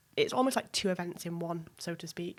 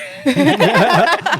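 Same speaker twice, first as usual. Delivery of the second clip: bright burst of laughter; loud laugh; 7 semitones higher, 16 dB louder than usual. Real, not acted. Guest joking that her friend came second, loud laugh. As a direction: laugh loudly at your own joke.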